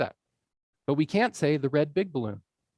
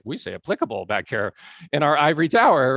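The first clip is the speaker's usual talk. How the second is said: louder, mock ivory-tower academic; hoity-toity academic; 7 dB louder than usual. Teacher mocking academics in their ivory tower, hoity-toity. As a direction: lightly send up a self-important academic. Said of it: "the hoity-toity academics back there".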